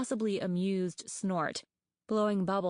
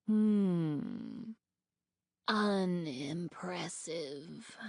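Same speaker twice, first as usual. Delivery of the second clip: very slow, softer disdainful croak; eerie rasp; much slower than usual. A narrator's reading. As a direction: drag the verdict out, unimpressed. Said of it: "creaky and quiet and rough and eerie".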